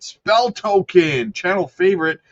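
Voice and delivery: sarcastic. Acted. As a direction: sell it with heavy irony. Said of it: "sense the sarcasm".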